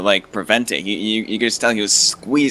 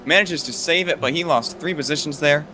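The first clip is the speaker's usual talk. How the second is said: excited; 6 semitones higher than usual. Real, not acted. Excited live race call.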